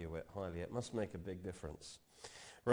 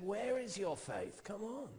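high, slow, coaxing challenge; preacher playing Jesus coaxing his followers, high and slow; challenging Jesus; 11 semitones higher than usual.

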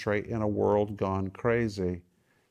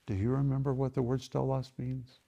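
ordinary talk, nothing marked

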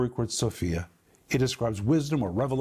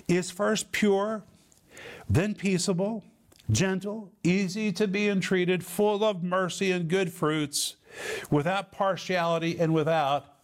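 measured preacher's recitation in a raised pitch; scripture reading; 5 semitones higher than usual. Real, not acted. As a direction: proclaim each quality of the verse with space around it. Reads the verse solemnly aloud.

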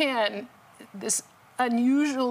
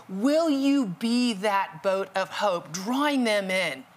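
preaching; 3 semitones higher than usual. Acted. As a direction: preach it with urgency. Preaches the lesson.

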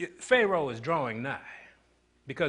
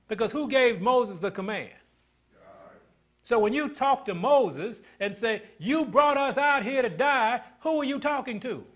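higher and louder, rolling sermon cadence; preaching at full force; 9 semitones higher, 6 dB louder than usual. Acted. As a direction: preach it out to the congregation.

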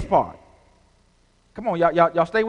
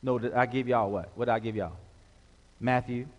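usual speaking voice

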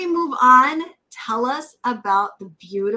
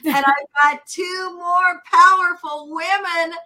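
excited hype; 5 semitones higher, 4 dB louder than usual. Real, not acted. Host hypes her guests.

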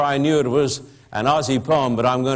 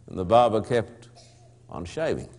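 low, softer dry refrain; 4 semitones lower, 5 dB quieter than usual. Acted. Narrator's refrain about the silent barber, low and soft. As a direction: drop it low and deadpan.

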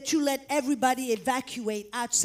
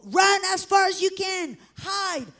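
high, loud warning call; imitating an urgent evacuation cry; retold shouted alarm; 7 semitones higher, 5 dB louder than usual. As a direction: urge everyone to flee, calling out. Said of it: "we hear again".

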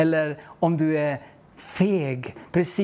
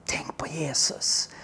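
breathy, secret whisper, slower; 4 semitones lower, 6 dB quieter, slower than usual. Retold or imitated. Whispering to the girl as her father leads her away. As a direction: slip her a hushed secret.

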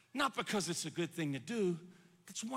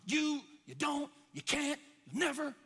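higher, jabbing accusations; mock accusing scream; 5 semitones higher than usual. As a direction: play the enemy hurling short taunts, without a full scream. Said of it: "the enemy will always come and scream".

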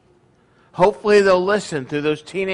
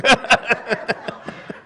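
breathy run of chuckles; laugh, pleased with your own joke; real laugh; 5 semitones higher than usual. Real, not acted.

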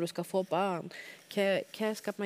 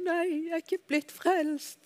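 higher, slow, tearful words; sobbing; 9 semitones higher, slower than usual. Retold or imitated. Telling her mother the news, sobbing. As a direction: sob it out, voice breaking. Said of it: "Så hylgriner begge to".

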